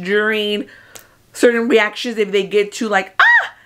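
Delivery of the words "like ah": high-pitched excited squeal. Real, not acted. Vlogger squealing ahead of the Oscar results. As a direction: squeal with giddy anticipation. Teasing she may snap wig.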